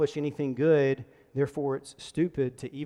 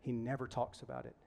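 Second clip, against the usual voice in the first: low-key, much softer retold confession; quiet sadness; 3 semitones lower, 13 dB quieter than usual. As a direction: imitate a wife gently letting a sad truth slip. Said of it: "you could sense her, like, sadness".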